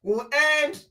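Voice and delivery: high-pitched, drawn-out quote of a woman; high imitation, stretching the words; imitating her. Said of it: "She said".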